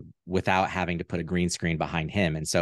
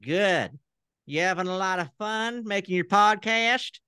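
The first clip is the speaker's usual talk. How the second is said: much higher folksy puppet voice greeting the host; Southern puppet character; 9 semitones higher than usual. Pretend. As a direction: play the friendly talk-show puppet. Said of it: "I'll try him on".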